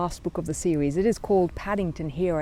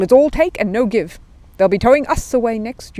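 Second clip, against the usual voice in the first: higher, louder market man complaining; neighbouring trader grumbling; 5 semitones higher, 9 dB louder than usual. A narrator's reading. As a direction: grumble to the crowd. Next-stall man voiced.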